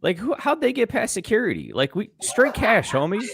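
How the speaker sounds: high, scoffing imitation; lightly voiced snob at the door; mock committee member. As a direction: sneer like a snooty official. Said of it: "They're gonna look around and be like".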